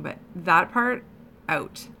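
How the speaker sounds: ordinary delivery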